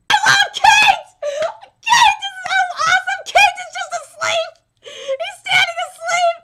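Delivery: high, loud, laughing adoration; gushing fan shouts. Real, not acted. Fan gushing over favourite character, high laughing shouts. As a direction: squeal your love for him.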